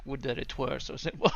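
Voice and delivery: clipped British line delivery; British accent. Pretend. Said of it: "now I'm stuck in, like, the British".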